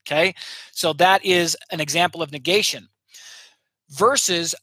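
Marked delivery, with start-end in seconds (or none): none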